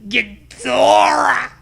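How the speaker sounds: slow stretched monster-name yell; drawn-out shout. Pretend. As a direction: announce the monster like a wrestler.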